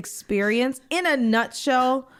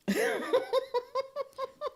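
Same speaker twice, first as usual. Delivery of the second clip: high, soft giggle; real laughter, light and high; amused laugh; 14 semitones higher, 9 dB quieter than usual. Real, not acted. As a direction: giggle quietly at the absurdity. Laughs at the accent question.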